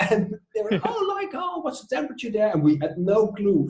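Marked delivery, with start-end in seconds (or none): 0.8-2.5 very high, excited mock employee; hammed-up high-pitched curiosity; gushing employee. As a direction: squeal the question like an over-keen park greeter. Acts out the employee's eager question.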